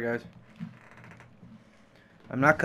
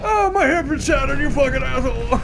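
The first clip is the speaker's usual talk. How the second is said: mock injured yell; 7 semitones higher than usual. Hammed-up pain at game fall.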